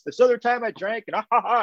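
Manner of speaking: playful mock laughing impression; mock old-timer laughter. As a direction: imitate old guys cracking up over their drinking tales. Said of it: "and then they all laughed".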